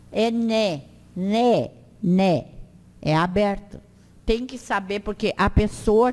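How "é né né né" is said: né said with an open, ringing n. Shown as a demonstration. Teacher drilling the open N sound. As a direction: repeat né clearly, the n open. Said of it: "o N é sempre".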